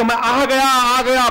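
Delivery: put-on child calling out as he runs back. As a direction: call out that you have arrived. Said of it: "पुकार कर". (पुकारता हुआ बच्चा)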